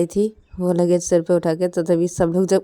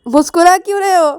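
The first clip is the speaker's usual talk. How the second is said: high, louder suspicious demand; annoyed question; 12 semitones higher, 7 dB louder than usual. Acted. Girl with luggage on her head confronts grinning friend, annoyed.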